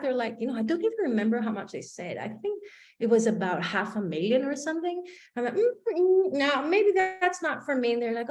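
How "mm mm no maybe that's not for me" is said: high, hesitant backing off at the price; hesitant refusal. Retold or imitated. Caller hears the price and backs off, high and hesitant. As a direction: balk politely at the cost.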